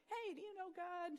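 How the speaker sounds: friendly evangelist calling out while riding by; cheery evangelist. Retold or imitated. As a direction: hail a stranger from a bike. Imitating evangelist on his bike.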